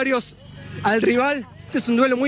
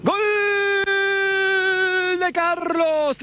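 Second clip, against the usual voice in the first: slow, higher stretched goal call; 8 semitones higher, much slower than usual. Acted. Commentator calling Carlos's goal, slow stretched cry. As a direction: stretch every syllable of the goal.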